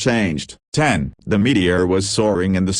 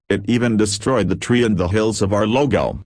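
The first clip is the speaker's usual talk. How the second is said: usual speaking voice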